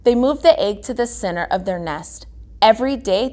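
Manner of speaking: ordinary delivery, nothing marked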